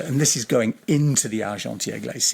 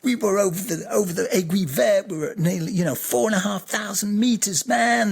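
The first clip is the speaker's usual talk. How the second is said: much higher, gushing mock-excited pilot voice; excited friends; 8 semitones higher than usual. Imitating excited fellow pilots bragging about their flight. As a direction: ham up the buzzing mates bragging. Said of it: "they were going like".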